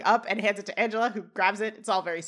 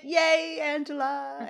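drawn-out, higher-pitched yay; greeting cheer; 5 semitones higher, much slower than usual. Acted. Friend greeting Angela at the door, cheering. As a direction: sing out a mock-happy greeting.

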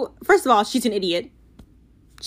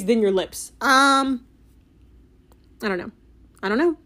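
usual speaking voice

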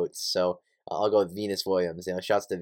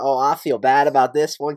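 put-on country accent Josh; 5 semitones higher, 7 dB louder than usual. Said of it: "That, that was my Josh impression".

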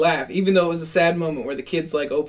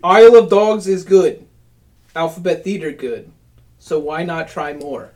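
haiku recital; 7 dB louder, slower than usual. Shown as a demonstration. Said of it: "You want me to give you a haiku".

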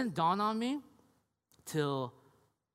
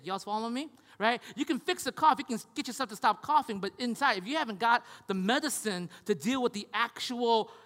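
raised, higher preaching voice; 5 semitones higher, 4 dB louder than usual. Acted. Pastor driving home a point to the congregation, preaching at full force.

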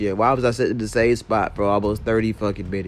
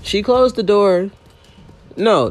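ordinary delivery

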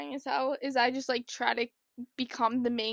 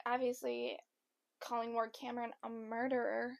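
ordinary talk, nothing marked

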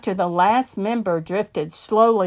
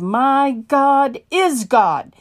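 preacher proclaiming God's power to a gunman; 4 semitones higher, 4 dB louder than usual. Retold or imitated. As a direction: proclaim it with a raised fist. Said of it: "raised one fist and proclaimed".

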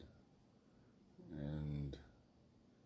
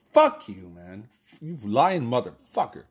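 retold yell; 4 semitones higher, 8 dB louder, slower than usual. Yelling at lying officer.

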